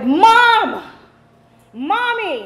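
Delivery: put-on high, drawn-out child's holler; calling for mom. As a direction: yell through the house for your mother. Child calls mother at home.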